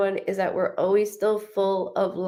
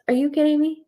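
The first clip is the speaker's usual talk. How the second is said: excited disbelief; 7 semitones higher than usual. Retold or imitated. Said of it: "She goes".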